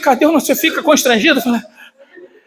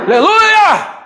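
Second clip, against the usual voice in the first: high, ringing aleluia; hallelujah cry; 8 semitones higher, 3 dB louder, much slower than usual. Real, not acted. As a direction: lift the church with it. Pastor exclaims to congregation. (aleluia exaltado)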